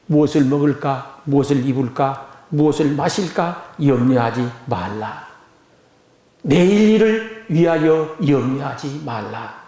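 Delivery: low, measured Bible reading; solemn scripture recitation. Acted. Preacher quoting Jesus to the congregation, solemn recitation. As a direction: recite it low and deliberately, phrase by phrase.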